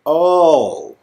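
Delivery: ordinary talk, nothing marked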